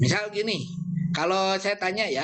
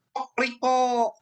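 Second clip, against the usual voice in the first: higher, slow Kokoriko; rooster crow; 9 semitones higher, slower than usual. Shown as a demonstration. Teacher demonstrates the German rooster sound to the class. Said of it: "Jerman beda lagi dia bilangnya".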